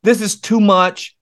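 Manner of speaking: high, loud, desperate cry of I'm done; exasperated outburst. Retold or imitated. Retelling his outburst to God, high and loud. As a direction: pour out frustration to God. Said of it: "I said".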